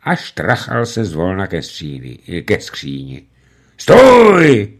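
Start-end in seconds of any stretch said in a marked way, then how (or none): 3.8-4.7 loud, high barked order; commanding shout. A narrator's reading. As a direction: stop him dead. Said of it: "velel Bill". (velitelsky zakřičet)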